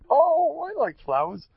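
high, loud swooning oh; mock-smitten wife; wife's gushing delight. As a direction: gush with exaggerated delight, starting very high. Wife voiced, suddenly liking flowers.

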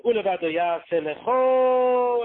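LECHO held long, high and loud; drawn-out emphasis. Shown as a demonstration. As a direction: build to a long, ringing Lecho. Reciting creed, one word stretched.

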